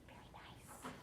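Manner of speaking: hushed, voiceless whisper; whispered praise. Real, not acted. Teacher praises children who fluttered like butterflies, whispered.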